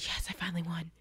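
whispered secret victory; triumphant whisper. Retold or imitated. She quietly gloats that she won the bet, whisper. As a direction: gloat under your breath.

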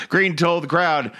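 ordinary talk

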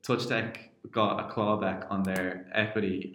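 ordinary delivery

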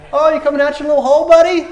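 high, loud, fast mocking jeer; trash talk. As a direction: taunt him like a playground bully. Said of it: "They talk trash back to him".

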